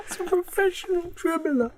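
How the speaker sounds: usual speaking voice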